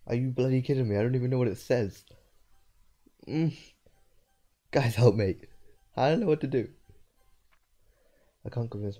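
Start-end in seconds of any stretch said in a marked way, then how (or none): 0.1-6.7 louder, higher pleading rant; loud disgusted outburst. Real, not acted. Player begging chat for help, loud disgusted outburst.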